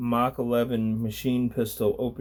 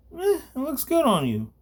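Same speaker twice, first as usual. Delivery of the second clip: mocking thug. Thug mocks the girl.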